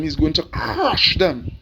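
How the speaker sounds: slow build into a long, drawn-out crush; emphasis on crush. Real, not acted. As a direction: grind out the word crush. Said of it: "I emphasize on that word crush".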